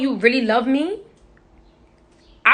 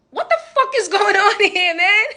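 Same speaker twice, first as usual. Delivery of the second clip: laughing disbelief; 10 semitones higher than usual. Retold or imitated. Exasperated with mom, laughing.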